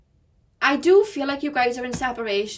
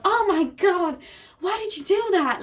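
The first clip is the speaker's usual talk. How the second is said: high, louder, overdramatic protest; hammed-up victim's cry of disbelief; mock outrage; 7 semitones higher, 4 dB louder than usual. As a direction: wail it like someone making a huge fuss. Mimics dramatic overreaction to light push.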